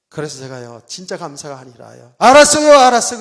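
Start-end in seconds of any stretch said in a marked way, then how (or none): 2.2-3.2 very loud, high, exasperated mock reply; grudging, crooked retort. Preacher re-enacting his crooked answer to God, exasperated. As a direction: act out fed-up giving in. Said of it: "삐딱해가지고 말하는데 그냥".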